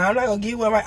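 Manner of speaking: ordinary talk, nothing marked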